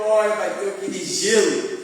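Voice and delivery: ordinary talk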